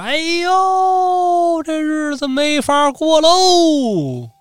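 high, long-drawn theatrical wail; hammed-up keening, stretching each phrase end; mock crying wife. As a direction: wail it out in singsong despair. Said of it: "看他媳妇儿从门口躺着打滚哭啊".

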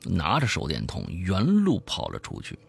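slow, weighted reveal building to a forceful burst; emphatic horror-story narration; dramatic storytelling. A narrator's reading. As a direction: draw out the grisly details, then drive hard. Tells a horror story aloud.

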